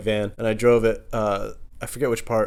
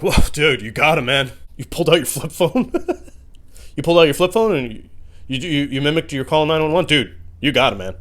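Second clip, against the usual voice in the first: bro voice; 5 semitones higher, 6 dB louder than usual. Retold or imitated. Friend mocking impressed.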